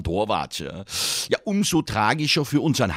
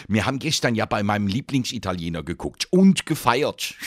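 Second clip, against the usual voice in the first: normal talking voice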